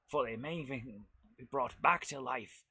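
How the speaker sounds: spooky narration. Acted. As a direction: whisper it like a horror trailer. Quotes a cartoon intro spookily.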